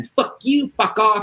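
high, sharp retold shout; imitated outburst of a fed-up relative; mock angry yell. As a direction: yell it like a family member losing it. Family intervention turning into fight.

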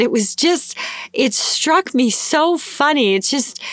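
high, tickled retelling without laughing; imitating amused cashier. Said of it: "She starts laughing".

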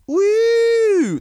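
long high-pitched "woo"; high whoop. Acted. Host capping his wrestler impression, high whoop. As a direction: let out a drawn-out high whoop.

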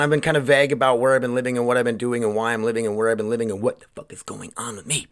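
ordinary talk

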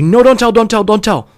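high, loud, rapid-fire begging; desperate pleading. Retold or imitated. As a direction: plead frantically like a panicked kid. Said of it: "so desperate and sad".

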